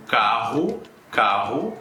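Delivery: carro with a throaty H-like R, kaho; rough H-sound R in carro; guttural double R. Shown as a demonstration. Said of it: "dois R's no meio da palavra".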